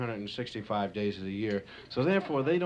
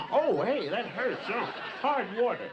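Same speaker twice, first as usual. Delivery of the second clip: hurt caveman; 8 semitones higher than usual. Acted. Caveman hit by hail.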